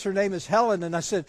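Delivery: usual speaking voice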